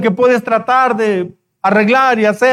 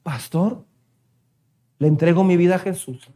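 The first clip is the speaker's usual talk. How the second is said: much softer, lower words of surrender; hushed quoted vow; 6 semitones lower, 6 dB quieter than usual. Retold or imitated. Young person surrendering to the pastor, hushed. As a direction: let it fall to a near hush.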